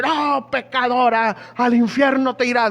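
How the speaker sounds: preacher mocks rigid believers who condemn a sinner; mock harsh preacher. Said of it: "somos bien inflexibles".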